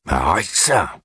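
put-on mild angry bark from an old man; sudden sharp order to stop; aboiement. A narrator's reading. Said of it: "aboya Dussender". (ordre sec, comme aboyé)

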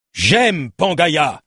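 cri étiré, plus haut; crie-le lentement, comme un aveu passionné. A narrator's reading. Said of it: "cria Nemrod". (cri passionné)

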